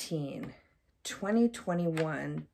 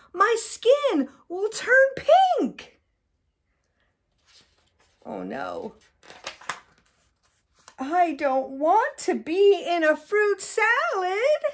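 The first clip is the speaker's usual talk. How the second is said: much higher, louder, slow hammed-up panic; panicked crocodile; 13 semitones higher, 9 dB louder, slower than usual. Mom reading to the child as a panicking crocodile, much higher and louder. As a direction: wail it like a cartoon croc in a fright.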